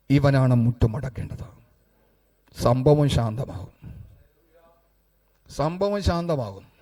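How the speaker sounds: low, measured pronouncement with long pauses; solemn preaching. Acted. Preacher telling the congregation how the storm stills, low and solemn. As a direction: drop low and let each phrase stand alone.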